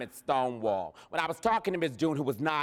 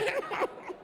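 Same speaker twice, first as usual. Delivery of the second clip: short high laugh; gleeful burst of laughter. Real, not acted. Laughs after triumphant quote.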